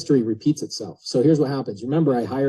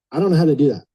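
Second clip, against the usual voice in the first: helpless confession; 3 semitones higher, 6 dB louder, much faster than usual. Retold or imitated. Retold helpless reply to mentor.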